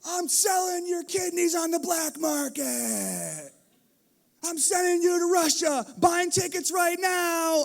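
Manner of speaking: high, loud, slow mock-furious rant; hammed-up angry dad ranting; loud, slow, mock-serious shouted threats; over-the-top threats. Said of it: "I'll be like".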